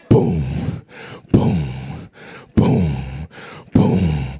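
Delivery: deep, low booming thuds; imitated ominous footsteps. Mother climbing to the kids' room, ominous booms. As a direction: drop very low for each heavy, separated step. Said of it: "the most fearsome sound".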